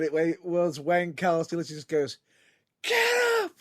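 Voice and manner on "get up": high-pitched yelled command; mock ring shout. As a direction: imitate someone yelling an order in the ring. Retelling a shouted ring order.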